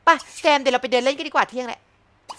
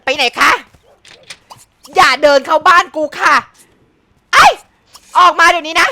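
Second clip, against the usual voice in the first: louder shouted orders; angry yell; 5 semitones higher, 11 dB louder than usual. Real, not acted. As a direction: drive the rival out of the house. Yelling at intruder.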